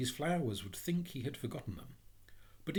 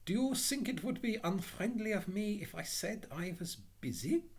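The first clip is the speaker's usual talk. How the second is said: high, meek little voice; shy, timid; 8 semitones higher than usual. A narrator's reading. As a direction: ask cautiously, afraid to give offence. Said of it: "he inquired in a shy and timid voice".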